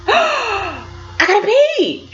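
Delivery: long, high mock wail; desperate wail. Bursting to pee while she waits, desperate. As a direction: stretch it out in comic desperation.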